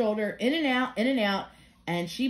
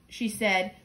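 plain reading voice

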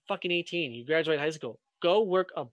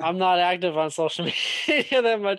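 laughing while talking; 2 semitones higher, 6 dB louder than usual. Real, not acted.

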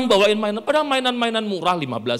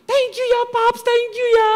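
very high, squealed thank-yous; hammed-up delighted wife; gushing thanks; 5 dB louder than usual. Wife thanking Paps for the unopened gift, high gushing squeal.

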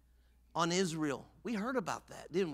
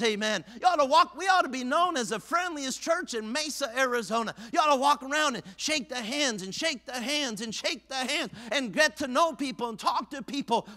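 raised, higher preacher's cadence; preaching at full force; 4 semitones higher, 5 dB louder than usual. Acted. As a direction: drive it home to the congregation.

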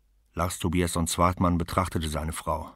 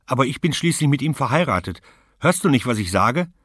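high, loud indignant protest; wife shouting her claim on her husband; furious wife; 8 semitones higher, 8 dB louder than usual. Acted. Wife to her rival, loud and indignant.

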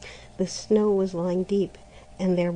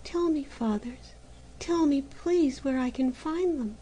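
put-on higher, softer begging girl; desperate plea; 6 semitones higher, 4 dB quieter than usual. A narrator's reading. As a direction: plead for help, near tears. Maruschka begs the months.

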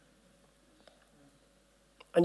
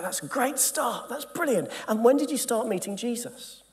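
bright encouraging reply; 8 semitones higher than usual. Retold or imitated. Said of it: "And I go".

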